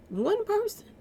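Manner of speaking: One person, jumped way up high in disbelief; incredulous repeat. Real, not acted. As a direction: hit One person high, like you can't believe it.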